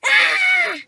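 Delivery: high loud shriek of fright; frightened scream. Acted. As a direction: scream in terror. Frightened by ghost voice.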